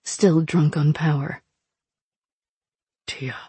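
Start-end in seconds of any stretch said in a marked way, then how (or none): 3.1-3.4 soft, hushed whisper. Acted. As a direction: breathe the name under your breath. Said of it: "Fox whispered".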